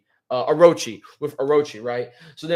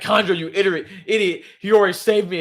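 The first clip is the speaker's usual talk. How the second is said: higher, louder mock scream at Kanjuro; hammed-up burning villain's cry; panicked yell; 5 semitones higher, 6 dB louder than usual. Orochi screaming while burning.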